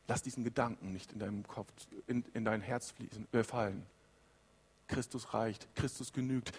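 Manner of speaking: soft, low and calm, like a pastor comforting; Paul quietly calming a worried believer; gentle reassurance. Acted. Paul's reassurance, voiced soft and low.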